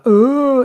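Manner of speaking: high sudden cry out; sleep shout. Retold or imitated. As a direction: let out a startled sleep shout. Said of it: "the shouting out thing".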